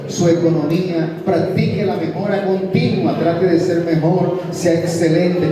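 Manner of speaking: loud, driving sermon delivery; rallying the congregation at full voice; fiery preaching. Acted. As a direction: preach it out to the whole church.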